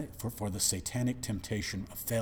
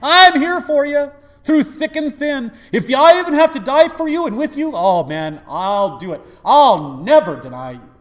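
much higher and louder cocky vow; mock boastful believer; 14 semitones higher, 16 dB louder than usual. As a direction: ham up the overconfident disciple. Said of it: "We talk like this".

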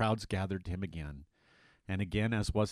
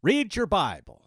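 mock scolding; 9 semitones higher, 9 dB louder, slower than usual. Paraphrasing Jesus' rebuke.

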